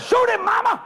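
very high-pitched egging on; bold child. Retold or imitated. Five-year-old to mama with the rifle, emboldened. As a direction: urge her on in a high kid voice.

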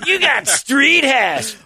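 put-on high, slow baby-talk dog voice; silly dog voice. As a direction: coo at the dog in a squeaky voice. Dog owner voicing pet.

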